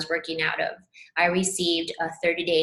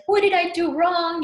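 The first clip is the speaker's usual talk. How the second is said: much higher and louder retold cry; frustrated outburst; 11 semitones higher, 6 dB louder than usual. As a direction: throw the question up in exasperation. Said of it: "I started to, like".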